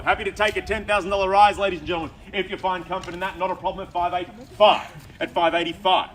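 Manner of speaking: booming auctioneer call. Real, not acted. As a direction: sell the rise to the crowd. Auctioneer calling for a rise.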